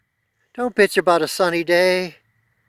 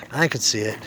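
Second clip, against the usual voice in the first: choked up; 7 semitones lower, 3 dB quieter than usual. Real, not acted. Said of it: "I got a little emotional".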